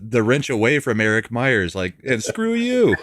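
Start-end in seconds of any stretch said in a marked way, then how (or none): 2.1-3.0 high-pitched, hammed-up defiance; exaggerated high voice, acting out defiance; mock defiance. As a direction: play a mock indignant send-off. Mimics someone snatching the wrench away.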